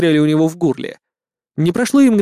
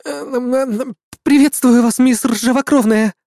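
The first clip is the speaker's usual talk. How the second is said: flustered stammer; 8 semitones higher than usual. A narrator's reading. Gnome lady stammers, flustered.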